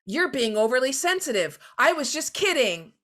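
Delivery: a hint of a defensive coworker; dismissive defensive retort. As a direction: brush off the complaint defensively. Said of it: "you don't suddenly say".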